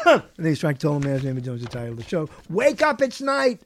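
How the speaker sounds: ordinary delivery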